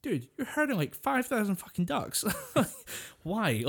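high, laughing mock outrage at the herder; cracking up while asking why; amused disbelief, voice jumping high. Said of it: "You're like".

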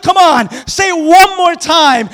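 slow, loud challenge; loud taunt. Acted. David dares Goliath to repeat it, loudly. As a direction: dare him to his face.

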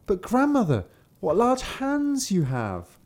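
put-on high, slow, wondering child; little girl voice. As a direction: gasp it out innocently. Kapturek przy łóżku.

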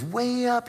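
'Way up' said high and stretched; emphatic high. Retold or imitated. Friend describing remote mountains.

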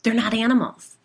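high, loud eager correction; excited. A narrator's reading. Gwen corrects her neighbour, eager and excited. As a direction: burst out with it, thrilled. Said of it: "she replied excitedly".

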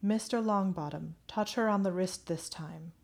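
hushed, receding instruction; half-heard voice. A narrator's reading. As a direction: speak softly, like a voice half heard. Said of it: "only heard half the words".

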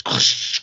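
voiceless mouth-made glass crash; quick sound-effect crash; imitated crash noise. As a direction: imitate a smash with a rushing hiss.

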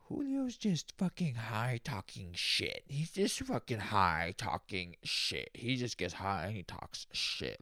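slow, put-on voice mocking himself; mock self-narration. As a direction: drag it out like someone talking about you.

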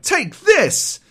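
high shout, kicking; battle cry. Retold or imitated. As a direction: yell it mid-kick. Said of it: "And she's like".

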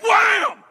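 high, explosive wham; shouted impact noise. Retold or imitated. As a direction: blast out the sudden jolt. Mimics turbulence hitting.

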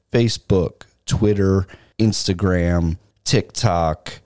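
slow, spelled-out sign-off read; podcast outro. Acted. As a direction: read the plugs like an announcer.

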